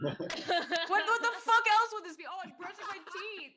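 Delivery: high, fast laughing protest. Real, not acted. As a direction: laugh through the denial. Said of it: "are you putting lipstick on".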